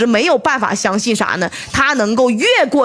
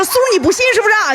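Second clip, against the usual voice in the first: fast, higher-pitched taunt; mock angry challenge; 9 semitones higher, much faster than usual. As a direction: throw the challenge back fast. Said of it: "说：". (胡塞武装愤怒质问，语速很快)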